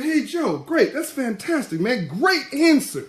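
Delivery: high, loud and bright mock praise; mock cheerful host. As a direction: gush a sarcastic great answer, pitched high. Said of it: "I said".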